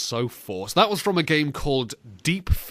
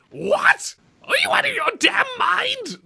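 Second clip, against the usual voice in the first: much higher, cracking shout. Retold or imitated. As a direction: yell in a squeaky broken octave. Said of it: "hit a new octave like".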